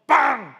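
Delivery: short, loud, high-pitched horn burst; forceful trumpet blast. Shown as a demonstration. Preacher showing how a loud trumpet signal sounds, forceful blast. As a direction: blow it out hard as one short toot. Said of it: "크게 불려면 힘을 줘서".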